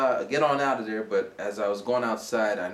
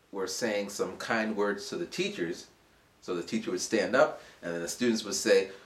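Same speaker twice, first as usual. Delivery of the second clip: ordinary delivery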